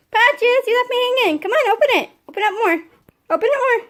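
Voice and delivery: high, loud and eager, urging him on; high coaxing dog voice. Real, not acted. Owner urging her dog Patches to open it, high and loud. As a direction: coax the dog with a bright high call.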